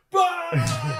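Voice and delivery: sudden high, loud cackle; hammed-up high-pitched laughing outburst; mock burst of laughter. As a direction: imitate an explosive guffaw. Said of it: "And he just laughs".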